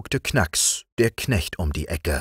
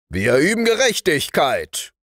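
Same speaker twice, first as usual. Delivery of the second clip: high, self-important sergeant; sergeant's reply; 8 semitones higher, 3 dB louder than usual. Acted. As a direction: answer with military firmness, pitched up. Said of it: "gab der Wachtmeister zur Antwort".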